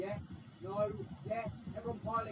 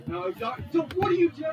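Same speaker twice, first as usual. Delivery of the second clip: rising to a high, loud protest; alarmed shout; 7 semitones higher, 11 dB louder than usual. Real, not acted. Player to a car ramming him, alarmed shout. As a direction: protest louder and higher as it goes.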